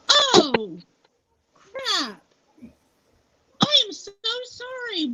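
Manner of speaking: drawn-out, high-pitched mock dismay; retold mortified apology. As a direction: imitate a horrified acquaintance, pitch swooping high. Acting the mortified acquaintance apologizing.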